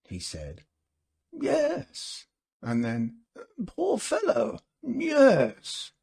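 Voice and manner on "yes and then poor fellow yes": high, pitying tremor on poor fellow; a catch of crying in the throat, remembering; mild tearful pity; sighing pity. A narrator's reading. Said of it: "Clayton heaved a realistic sigh".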